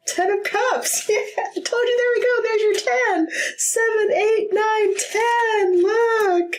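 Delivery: high, giddy counting of the cards; gleeful high-pitched counting; excited squeal. Real, not acted. Card confirms prediction, laughing.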